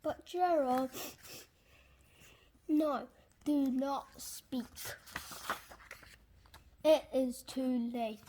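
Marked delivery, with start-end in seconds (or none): none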